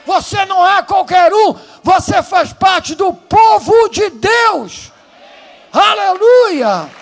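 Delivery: high, loud, slow preacher's cry; shouted praise, sermon peak; preaching at full force. Real, not acted. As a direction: proclaim it over the crowd.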